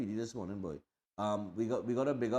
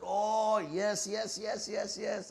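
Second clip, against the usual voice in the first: high-pitched gush of understanding; mock-delighted string of yeses, held high; delighted recognition; 10 semitones higher, much slower than usual. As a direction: imitate her sudden realization, voice shooting way up. Acting out her sudden delighted understanding.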